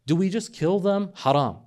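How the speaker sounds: ordinary talk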